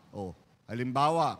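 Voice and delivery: ordinary talk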